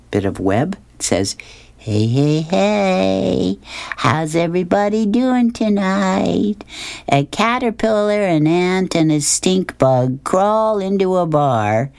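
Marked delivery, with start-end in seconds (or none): 1.8-11.9 high, drawn-out open-mic opener; stand-up comic spider. Acted. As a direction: work the crowd like an amateur comic. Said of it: "the spider gets up onto the mic".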